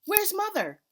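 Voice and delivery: high, loud frantic call; yelling. A narrator's reading. Mother yelling for grandma at the fire. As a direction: call out in panic for her mother. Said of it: "Mama yelled".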